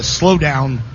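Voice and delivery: ordinary talk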